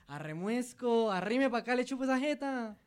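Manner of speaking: high, cheeky Santander come-on; Santander accent. Pretend. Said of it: "Se dice".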